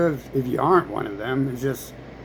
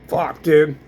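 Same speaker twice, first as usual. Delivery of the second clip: usual speaking voice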